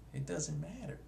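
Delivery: a breezy high echo, trailing upward. Shown as a demonstration. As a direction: toss it off lightly up high.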